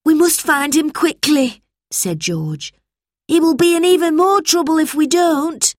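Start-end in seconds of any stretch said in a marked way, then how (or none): 0.0-1.6 put-on high, anxious child's voice; worried boy, urgent. A narrator's reading. 3.3-5.7 put-on high, louder child's voice; worried boy, urgent. A narrator's reading.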